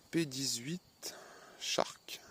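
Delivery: slow whispered product name; breathy, drawn-out whisper; hushed reveal. Real, not acted. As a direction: whisper it, letting each part land.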